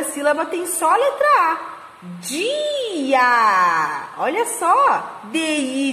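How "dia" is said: "Dia" stretched long on both vowels; drawn-out syllables. Shown as a demonstration. Teacher blending two syllables for the class, drawn out. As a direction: glide slowly from "Di" into a long "a". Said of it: "a junção dessas sílabas".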